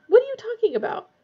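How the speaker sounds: high, loud, bewildered question; incredulous, pitched well up; gaping disbelief. A narrator's reading. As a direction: ask it in startled disbelief. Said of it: "Ron gaped at her".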